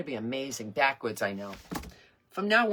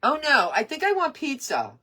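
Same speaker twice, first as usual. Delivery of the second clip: loud, high call across the room; 9 semitones higher, 6 dB louder than usual. Real, not acted. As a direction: raise your voice to change the order. Calls food order to someone off-camera.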